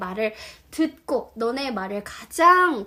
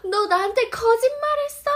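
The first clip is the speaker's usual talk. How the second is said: acted shock; 10 semitones higher, 4 dB louder than usual. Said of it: "거짓말하다예요".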